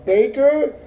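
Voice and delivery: high, loud mock-uncertain surname; scammer's questioning answer. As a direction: imitate a scammer offering a dubious name as a question. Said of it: "I can hear him like that".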